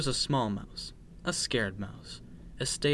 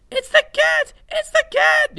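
very high, loud mouse squeal; panicked cry; 14 dB louder than usual. A narrator's reading. Frightened little mouse warns his sister of the cat, panicked cry. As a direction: squeal the alarm at the top of your voice. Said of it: "he cried".

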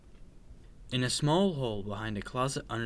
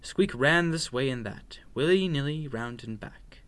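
ordinary delivery, nothing marked

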